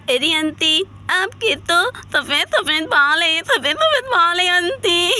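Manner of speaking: playing a little child, sing-song and giggly; childlike talk. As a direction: prattle like a small kid teasing an auntie. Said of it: "Laila यहाँ पर बिल्कुल बच्चों की तरह बातें करने". (बच्चों की तरह बोलते हुए)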